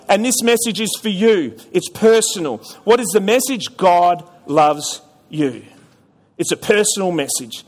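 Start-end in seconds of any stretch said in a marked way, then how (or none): 3.8-5.6 tender, slow. Real, not acted. Personal message to the congregation.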